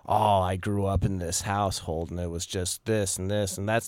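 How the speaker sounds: low, murmured imitation of a self-pitying person; mock self-pity, played low; whiny victim voice. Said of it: "people identify with their traumas".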